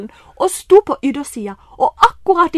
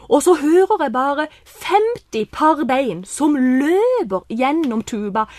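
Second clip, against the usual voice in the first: normal talking voice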